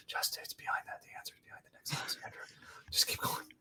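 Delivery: hushed, voiceless mock obsession; whispered self-urging. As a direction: whisper it like a secret mantra to yourself. Retelling urging himself on to knock.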